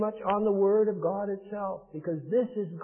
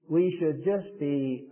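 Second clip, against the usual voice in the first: ordinary talk, nothing marked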